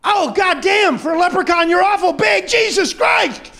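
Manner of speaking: high, loud comic screaming; pained shouting. Acted. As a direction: shout frantically through acted pain. Man in pain, demanding his money.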